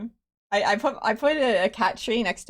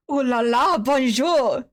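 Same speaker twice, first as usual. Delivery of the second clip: louder, hammed-up French accent; higher-pitched, silly fake French greeting; bad French accent; 4 semitones higher, 4 dB louder than usual. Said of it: "really bad French".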